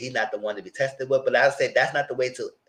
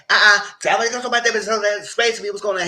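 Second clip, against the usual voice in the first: higher, louder, rushed mock demand; retold insistent interruption; 8 semitones higher, 4 dB louder, faster than usual. Max cutting off Cali Boy's explanation, insistent retold demand. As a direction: cut in over him, imitating his demand.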